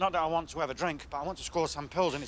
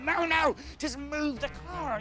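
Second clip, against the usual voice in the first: exasperated plea; 9 semitones higher, slower than usual. Retold or imitated. Man with a car on his foot interrupting, exasperated and high. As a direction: cut in high and slow down on the demand. Repeats plea over onlookers' analysis.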